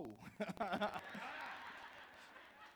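rising laugh; a rising chuckle at his own story. Real, not acted. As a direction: laugh at yourself.

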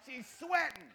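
loud, rising preacher riff; fired-up preaching. Acted. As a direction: preach it hard to the crowd.

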